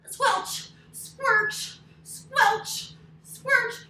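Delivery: loud, high squelching sound words; squelchy mud chant. Acted. Story teacher to kids, squelchy mud chant.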